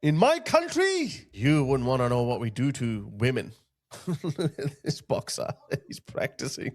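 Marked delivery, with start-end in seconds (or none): 0.0-1.1 rising, much higher mock outrage toward the end; mock indignant man. Imitating the man scolding the female bus driver, rising mock outrage. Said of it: "And he's like". 4.1-6.7 softer, breathy laugh through the words. Real, not acted. Vlogger mocking the attacker, quietly laughing.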